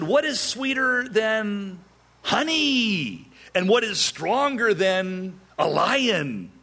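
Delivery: high, drawn-out, sing-song answer; smug taunt. Acted. Groomsmen flaunting the solved riddle to Samson, smugly taunting.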